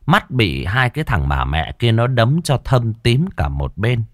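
plain reading voice

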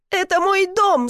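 high, heated yell; yelling back. A narrator's reading. Woman arguing with rescuer over her house, yelling. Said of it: "заорала в ответ".

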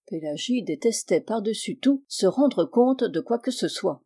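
plain reading voice

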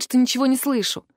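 ordinary delivery

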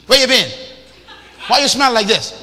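loud, high, accusing nag of a wife; nagging wife. Retold or imitated. Wife nagging husband as he walks in, shouting. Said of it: "She goes".

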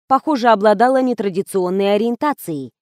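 ordinary delivery

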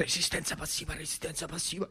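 hushed, breathy repeated vow; whispered defiance. Acted. Slapped boy vowing passive resistance, hushed whisper. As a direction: whisper it to yourself, stubborn.

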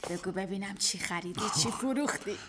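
lightly voiced wife, low and soft; a hint of a tired wife asking.

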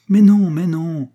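high, loud, slow "mais non"; emphatic protest. Acted. Butler protesting to his master, loud and emphatic. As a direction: insist hard, drawing out each non.

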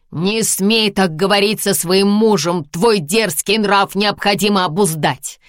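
loud, high angry shout; harsh barking commands. Acted. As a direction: shout her down, furious. Husband scolds bride angrily.

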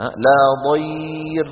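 لا ضير recited slowly with a thin ra; light ra recitation. Shown as a demonstration. Teacher reciting an example to students, light ra. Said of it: "وقبلها ياء ساكنة".

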